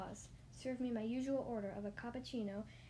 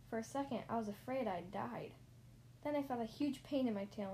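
plain reading voice

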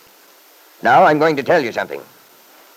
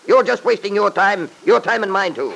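angry shouting; 8 semitones higher than usual. Acted. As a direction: snap at her in an angry rant. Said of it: "stop shouting".

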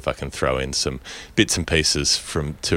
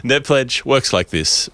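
higher, slow, deliberate segment intro; slow announcer voice; 8 semitones higher, 4 dB louder, slower than usual. Acted. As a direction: announce it like a show ritual.

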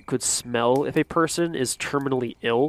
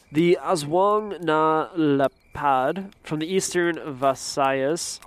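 Spanish accent; 3 semitones higher, slower than usual. Shown as a demonstration. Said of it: "like, a Spanish accent on everything".

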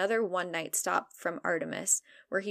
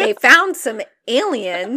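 slow, loud and high plot twist announcement; mock dramatic reveal; 5 semitones higher, 12 dB louder, slower than usual. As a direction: drag it out like a big reveal. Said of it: "Plot twist".

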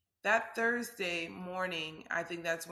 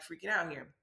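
ordinary talk

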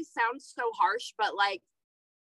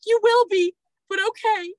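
put-on high, louder sulky child voice; grudging kid; 8 semitones higher, 5 dB louder than usual. Son grudgingly agrees to nap, high sulky kid voice. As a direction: concede it like a sulking boy.

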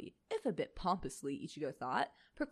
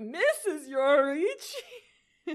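mock dub voice; 11 semitones higher, 11 dB louder, much slower than usual. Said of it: "they're like".

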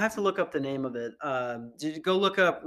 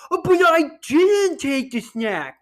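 high, loud, drawn-out mock whine; whiny protest; 10 semitones higher, 9 dB louder than usual. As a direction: imitate a wronged kid protesting slowly and loudly. Child protesting to teacher, acted.

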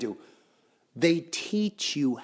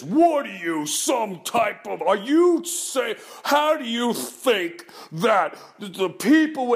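mock outrage; 7 semitones higher, 4 dB louder, slower than usual. Mocking outraged accusers.

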